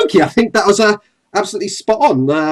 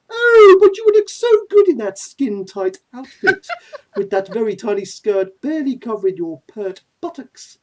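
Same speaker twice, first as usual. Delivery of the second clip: leering, high-swooping villain; Pegasus Maximilian; 6 semitones higher, 5 dB louder than usual. Acted. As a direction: coo loudly, then sink into relish. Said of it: "Pegasus Maximilian".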